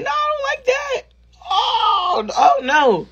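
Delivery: mock panicked squeal. Rebuffing a guy who talks rough, mock panicked high squeal. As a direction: squeak out a hammed-up cry of protest. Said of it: "I was like".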